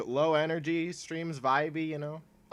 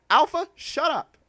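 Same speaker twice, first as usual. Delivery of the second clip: mock scold; 12 semitones higher, 8 dB louder, slower than usual. Playfully scolding a chat member.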